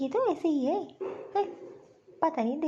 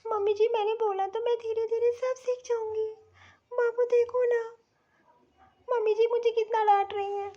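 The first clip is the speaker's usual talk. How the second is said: whiny complaint; 6 semitones higher than usual. Acted. Bahu complains to husband about scolding.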